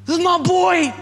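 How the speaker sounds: high, swelling cry of pride; the Father proudly claiming his child; proud heavenly father. Pretend. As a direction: claim them as his own, beaming. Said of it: "looks at you and says".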